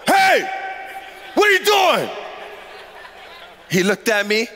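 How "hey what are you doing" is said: acted police voice. Pastor confronts a man beating a girl, authoritative shout. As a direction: call out like police stopping someone. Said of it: "deepened my voice a little bit".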